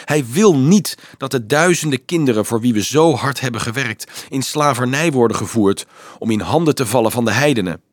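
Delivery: ordinary delivery, nothing marked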